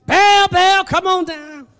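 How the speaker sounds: high, loud pagan chant, easing off at the end; imitated false prophets calling their god; mock incantation. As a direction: cry out to an idol. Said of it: "They started their incantations".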